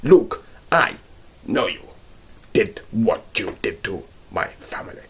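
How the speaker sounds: fading, whispered dub line; dubbed kung fu hero. Retold or imitated. Mocking a badly dubbed revenge line, fading. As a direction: let the threat trail into a hush. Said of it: "kind of humorous".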